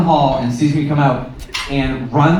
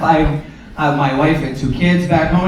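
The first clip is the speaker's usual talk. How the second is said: ordinary talk, nothing marked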